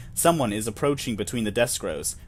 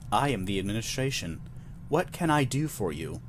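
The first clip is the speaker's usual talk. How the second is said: a hint of a neutral synthetic voice, softer; 2 semitones lower, 6 dB quieter than usual. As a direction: speak like a mild loudspeaker announcement. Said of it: "in a neutral synthetic voice".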